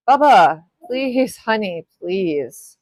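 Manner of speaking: loud call across the house. Real, not acted. Mom calling her son Bubba to stop the noise, loud call.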